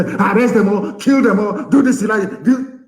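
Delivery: high, urgent mock commands; mock-agitated politician voice; imitated orders. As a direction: rattle off orders as an excitable politician. Voicing politicians giving orders.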